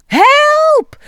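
very high, loud mock scream; startled shriek. A narrator's reading. Iris sees a frog in Michiel's throat, high startled shriek. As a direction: shriek as if something jumped out. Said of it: "Ze springt met een gil achteruit".

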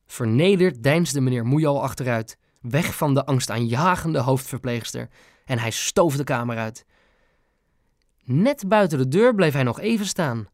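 plain reading voice

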